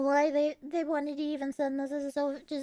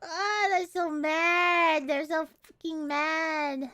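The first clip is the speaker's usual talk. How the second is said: ordinary talk, nothing marked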